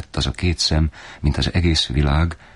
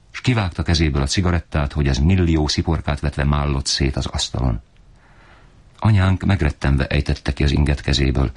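usual speaking voice

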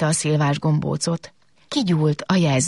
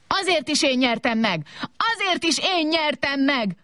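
high, stubborn insistence, climbing on the repeat; defiant squabbling; 11 semitones higher than usual. Acted. Robots fighting over who won, defiant squabbling. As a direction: squabble like children, pitch pushed right up. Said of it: "pofoszkodtak, verekedtek tovább".

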